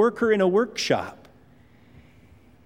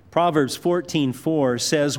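ordinary talk, nothing marked

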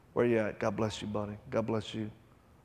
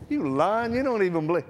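much higher and louder mock rage; scornful shout; 12 semitones higher, 4 dB louder than usual. Preacher chasing the protester out of his dojo, loud scorn. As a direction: shoo him off, half-laughing scorn. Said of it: "I said".

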